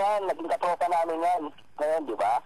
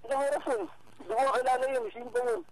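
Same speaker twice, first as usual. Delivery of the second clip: mock innocence; 9 semitones higher, 3 dB quieter than usual. Real, not acted. Playing dumb about the sister.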